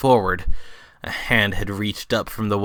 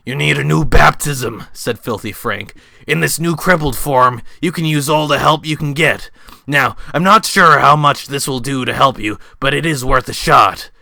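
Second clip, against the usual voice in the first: higher, louder gruff-toned character voice; Filthy Frank voice; 3 semitones higher, 6 dB louder than usual. Acted. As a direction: play Frank offering the baptism.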